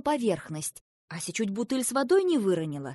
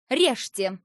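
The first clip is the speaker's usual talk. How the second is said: a sharp, louder order; commanding; 2 semitones higher, 8 dB louder than usual. A narrator's reading. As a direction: order the cutting like a princess. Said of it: "приказала речная царевна".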